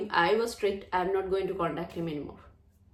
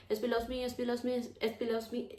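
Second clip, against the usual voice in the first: softer, higher-pitched run of repeats; rote affirming; 4 semitones higher, 6 dB quieter, faster than usual. Shown as a demonstration. Coach showing another way to affirm, higher and soft. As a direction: chant it softly and higher, on one level. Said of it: "you can affirm like that".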